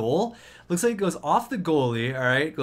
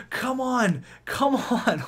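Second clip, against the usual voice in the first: eager come on, pitched up; pleading with the team to score; urging, higher pitched; 7 semitones higher, slower than usual. Real, not acted. Urging team on late in game.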